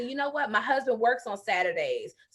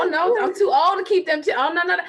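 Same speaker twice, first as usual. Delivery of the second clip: loud, higher, fast fake protest; mocking excuse; 7 semitones higher, 6 dB louder, faster than usual. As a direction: whine out the excuse, hammed up.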